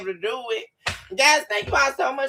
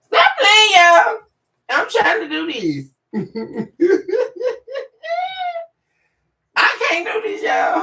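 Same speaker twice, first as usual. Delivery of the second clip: higher and louder, words cracking through laughter; laughing protest; 11 semitones higher, 7 dB louder than usual. Real, not acted. Telling viewers to stop playing while laughing hard, laughing protest. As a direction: giggle while begging them to stop.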